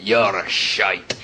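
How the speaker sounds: put-on low, very slow Scottish drunk growl; drunk Scottish heckler. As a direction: slur it like a drunk Scot at the front. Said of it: "he just went".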